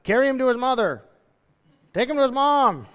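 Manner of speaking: acted-out helpless dad; father passing child off. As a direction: hastily hand the kid off. Acts father ordering servant away.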